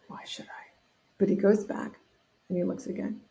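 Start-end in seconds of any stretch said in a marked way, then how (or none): 0.1-0.7 whispered aside. Retold or imitated. Quoting Chuck's resistance.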